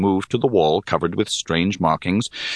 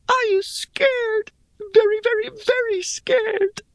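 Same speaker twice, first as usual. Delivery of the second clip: high creature voice. Acted. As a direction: squeak it like a scared tiny creature. Shim asks fearfully.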